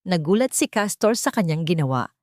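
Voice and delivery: ordinary delivery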